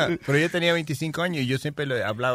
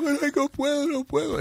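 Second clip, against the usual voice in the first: high, soft, whiny crying imitation; mock crying plea; 12 semitones higher, 5 dB quieter than usual. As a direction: imitate her begging through tears. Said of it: "empezó a llorar". (imitando un llanto agudo y suave)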